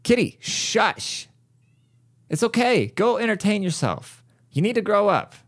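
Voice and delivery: high-pitched coaxing scold; talking to a pet. Real, not acted. Telling the crying kitty to settle, high pet-talk voice.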